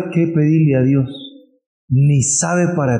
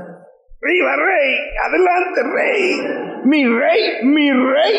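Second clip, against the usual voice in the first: much higher, slow drawn-out cheers; gleeful cheering; 12 semitones higher, slower than usual. Acted. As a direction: hail the king with wild joy. Madman cheering in the parade.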